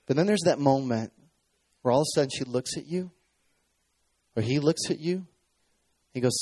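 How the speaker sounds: ordinary talk, nothing marked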